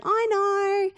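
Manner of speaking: put-on high-pitched, drawn-out teen protest; know-it-all teenager. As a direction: imitate a teenager brushing off parents. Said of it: "Teenagers love to say they know it all".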